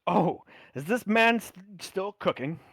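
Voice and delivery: high and loud, pleased; bright surprise at old friend; old sailor delighted. Acted. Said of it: "He goes".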